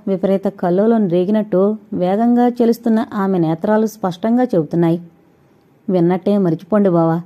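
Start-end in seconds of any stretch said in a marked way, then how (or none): none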